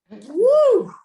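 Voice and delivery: high, loud cheering whoop. A narrator's reading. Class cheering.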